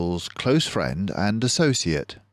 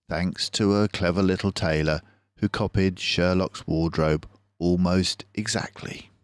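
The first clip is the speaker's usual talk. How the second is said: plain reading voice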